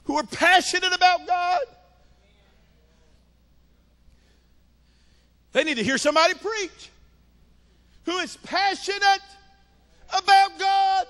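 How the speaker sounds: high, ringing pulpit proclamation; full-force preaching. Acted. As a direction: lift each phrase high and hold it.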